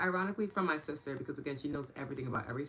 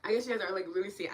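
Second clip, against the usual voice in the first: ordinary delivery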